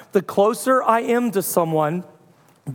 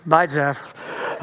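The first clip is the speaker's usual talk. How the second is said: lower, flat brush-off; curt friend; 6 semitones lower, much slower than usual. Retold or imitated. Busy friend answering quickly, lower and curt. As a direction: clip it short and hang up. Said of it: "he's like".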